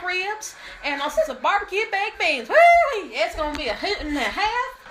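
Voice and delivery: high, hammed-up country cowgirl accent; cowgirl twang. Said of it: "Be, uh, cowgirl".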